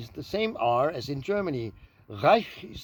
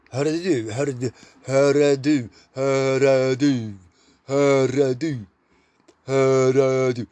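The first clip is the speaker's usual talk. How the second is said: mock drill; 3 semitones lower, 4 dB louder than usual. Shown as a demonstration. Comic drilling a Swedish phrase on the audience. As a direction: hammer out "hörru du" like a drill. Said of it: "You have to slow down".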